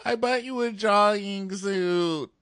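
high, slow put-on girl voice; high whiny mock girl. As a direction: whine it as a complaint. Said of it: "continues mock Maddie".

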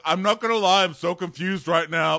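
loud, high mock yell; hammed-up confused rage; mock beast outburst. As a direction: bellow it like a worked-up brute. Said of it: "Like Hulk".

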